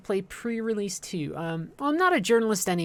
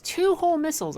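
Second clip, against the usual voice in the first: exasperated; 11 semitones higher, 5 dB louder than usual. Real, not acted. Wasted missiles, game frustration.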